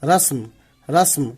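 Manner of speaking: "رسم" in one syllable, rasm; closed final consonants. Shown as a demonstration. Teacher showing learners where sound ends, "رسم" pronounced rasm. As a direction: run s straight into m in "رسم". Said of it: "میم پہ آواز ختم کر دینی ہے".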